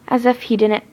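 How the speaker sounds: ordinary delivery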